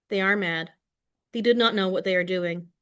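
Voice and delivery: ordinary delivery, nothing marked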